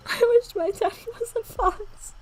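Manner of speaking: high, slow, half-crying laughter through the line; laughing rereading. Acted. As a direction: repeat it breaking up with laughter. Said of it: "that sounds so messed up".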